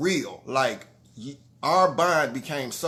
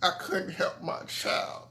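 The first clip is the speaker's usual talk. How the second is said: soft and choked, fading to a whisper; fighting back tears as you say it; real tears; 6 dB quieter than usual. Real, not acted. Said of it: "Sorry, though".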